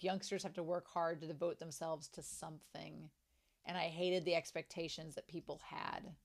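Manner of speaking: low, soft recital; reading aloud. Acted. Fan reading a character's thoughts on hard work, low and soft. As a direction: read it out quietly and low.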